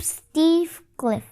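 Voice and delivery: usual speaking voice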